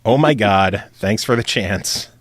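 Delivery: ordinary talk, nothing marked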